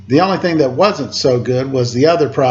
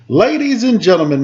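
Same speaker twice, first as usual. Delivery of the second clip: announcing; 6 semitones higher than usual. Acted. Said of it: "Mister Kaplan announced".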